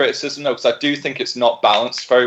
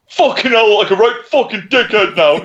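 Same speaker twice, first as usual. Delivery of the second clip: higher and a little louder, mock fed-up swearing; embarrassed outburst; 6 semitones higher, 4 dB louder than usual. Humiliated customer swearing at the clerk, mock embarrassed anger. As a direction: blurt it out red-faced. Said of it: "and goes".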